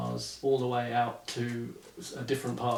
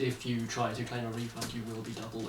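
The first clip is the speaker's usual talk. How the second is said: mock fine print; 3 semitones lower, 13 dB quieter, faster than usual. Mock legal warning, much softer.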